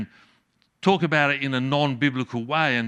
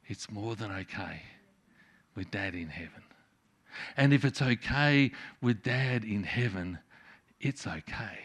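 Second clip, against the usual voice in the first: soft reassurance; 6 semitones lower, 9 dB quieter than usual. Real, not acted. Tender reassurance at sermon's close.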